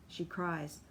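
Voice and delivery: plain reading voice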